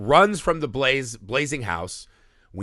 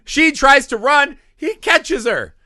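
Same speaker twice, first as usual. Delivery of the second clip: much higher and louder, racing through the action; excited climax recap; 13 semitones higher, 9 dB louder than usual. Real, not acted. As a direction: tell the chase at full excitement.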